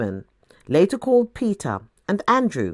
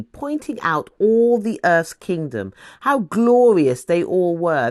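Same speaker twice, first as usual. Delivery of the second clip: ordinary delivery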